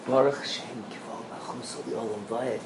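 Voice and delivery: hushed, low undertone from the grave; quiet whisper from the grave. Retold or imitated. Teacher voicing the dead Yaakov's hushed reply. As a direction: breathe it out barely voiced. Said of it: "he's saying".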